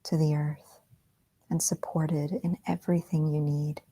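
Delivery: soft, whispery meditation guide; guided meditation. Acted. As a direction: ease them gently back into the room.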